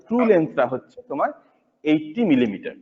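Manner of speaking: ordinary talk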